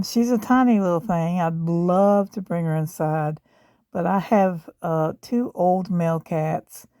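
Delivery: usual speaking voice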